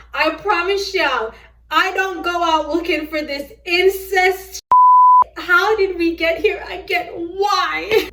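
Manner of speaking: loud, high-pitched exasperation; exasperated outburst. Real, not acted.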